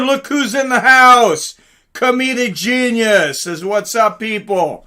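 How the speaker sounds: ordinary delivery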